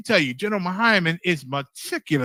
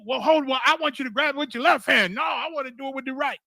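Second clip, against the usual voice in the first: higher-pitched mock bickering; petty quarrel; 8 semitones higher than usual. Two brothers bickering over which hand holds the cup, mock squabble.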